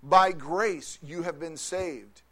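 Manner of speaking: measured scripture reading; solemn reading. Acted. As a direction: read the verses with weight. Reading Ephesians aloud.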